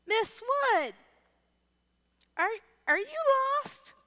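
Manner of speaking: put-on teenage student. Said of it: "and they go".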